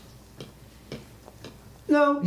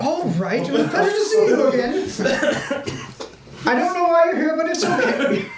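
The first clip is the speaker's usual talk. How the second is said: high, louder, giggling polite farewell; laughing NPC voice; 11 semitones higher, 3 dB louder than usual. Acted. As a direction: play the host cracking up mid-line.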